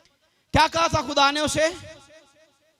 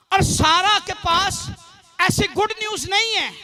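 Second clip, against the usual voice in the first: raised, emphatic preacher's delivery; preaching; 3 semitones higher, 5 dB louder than usual. Real, not acted. Preacher declares God knows our names, high-energy preaching. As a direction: proclaim it in measured phrases with pauses.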